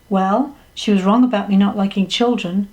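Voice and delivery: usual speaking voice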